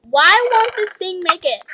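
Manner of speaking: ordinary talk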